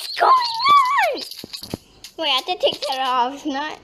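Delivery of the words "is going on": very high, loud squeal; a piercing squeal of alarm; shocked shriek. Real, not acted. Shocked at strangers in base.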